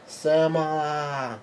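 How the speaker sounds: high, long drawn-out wail of a word; mock yell. Lillian still yelling for Semla, drawn-out mock yell. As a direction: stretch it out high without real volume. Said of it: "Lillian's still yelling out".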